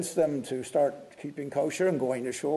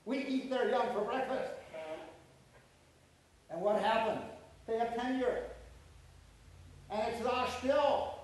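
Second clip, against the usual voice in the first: away from the microphone; off-mic; 3 semitones higher, 6 dB quieter than usual. Real, not acted. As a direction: talk from across the room. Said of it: "I'm gonna get away from the mic".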